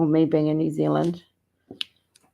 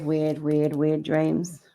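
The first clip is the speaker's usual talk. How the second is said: drawn out; much slower than usual. Real, not acted. Playful drawn-out self-description.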